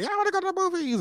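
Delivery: put-on eager high kid voice; excited kids. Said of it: "Everybody's like".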